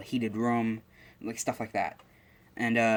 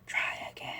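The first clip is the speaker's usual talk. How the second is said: little whispered voice; 6 dB quieter than usual. Retold or imitated. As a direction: whisper it like a voice in someone's head. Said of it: "hears a little voice going".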